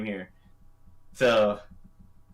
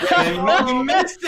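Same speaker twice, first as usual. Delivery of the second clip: teasing shout; 12 semitones higher, 6 dB louder, slower than usual. Real, not acted. Teasing a late guest.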